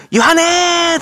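high, drawn-out cheer call; imitierter Fan-Ruf. Retold or imitated. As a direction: imitate an idol-concert call, stretching the last vowel high. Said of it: "掛け声聞かれた瞬間".

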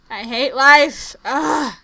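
loud slow groan of I hate life; real annoyance; frustrated. Real, not acted. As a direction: vent the frustration.

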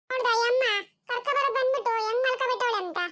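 put-on high-pitched kid voice; grumbling child. Child complains that mother has gone to sleep, grumbling child voice.

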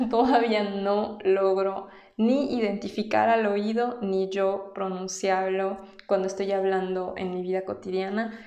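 ordinary talk, nothing marked

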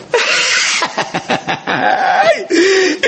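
hearty laugh. Acted. Uncle greets niece with big laugh.

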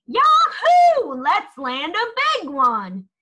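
excited fishing whoop; too loud. A narrator's reading. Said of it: "his words came out too loud".

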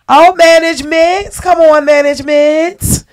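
high, loud playful call across the room; sing-song shout to the back. Real, not acted. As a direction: hype up management from the stage. Host calling out to management.

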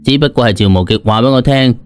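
plain reading voice

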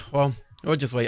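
ordinary talk

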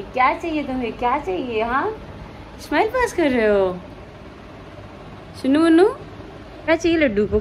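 playful mother scolding her newborn. Real, not acted. Mother talking to her baby.

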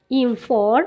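ordinary talk, nothing marked

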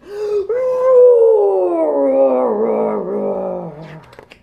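big gasp of surprise; dramatic gasp. Acted. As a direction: gasp loudly, hammed up.

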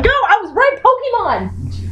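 high triumphant cry, last word stretched; excited outburst. Real, not acted. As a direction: crow that you were right, way up high. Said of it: "Calm down".

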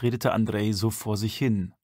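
ordinary delivery, nothing marked

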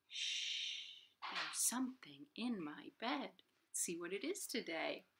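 hushed whisper, full of secret; storytime whisper. Acted. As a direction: whisper like a surprise is hiding under the covers. Secret thing in bed.

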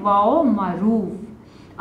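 put-on drawn-out term, spoken slowly for children; stretched teacher's answer. Shown as a demonstration. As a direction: say it slowly so the class can follow. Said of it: "वो क्या कहलाते हैं?".

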